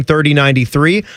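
ordinary talk

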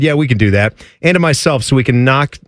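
usual speaking voice

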